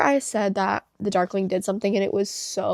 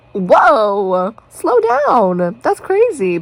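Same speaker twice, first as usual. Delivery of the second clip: shocked; 7 dB louder, much slower than usual. Retold or imitated. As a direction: replay the stunned reaction. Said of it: "I was just so shocked".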